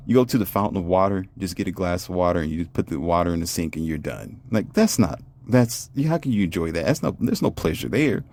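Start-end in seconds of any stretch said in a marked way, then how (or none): none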